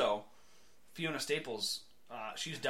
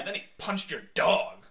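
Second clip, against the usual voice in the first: ordinary talk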